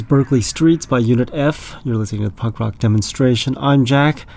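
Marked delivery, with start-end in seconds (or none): none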